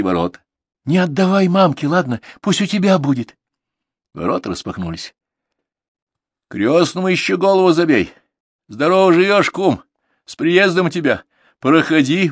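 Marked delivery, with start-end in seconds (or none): 0.8-3.4 put-on girlish pleading. Girl pleading with godfather. 6.5-12.3 loud, high hostess welcoming the guest; hearty loud greeting. Acted. Hostess loudly greeting her son's godfather.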